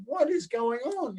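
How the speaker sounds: slow, high puzzled muttering to himself; bemused inner voice; puzzled thought. Retold or imitated. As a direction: wonder what on earth is happening.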